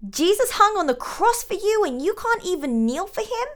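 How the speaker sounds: very high, indignant question; high-pitched outraged mother; rising pitch. A narrator's reading. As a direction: scold in climbing, scandalised disbelief. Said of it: "The pitch of Katrina's voice rose with each word".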